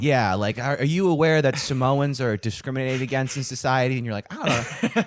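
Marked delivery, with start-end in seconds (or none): 4.3-5.0 high-pitched clueless shrug; mock uncertainty. Answering a canvasser about Samoans, high mock uncertainty. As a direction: pitch it way up, unsure.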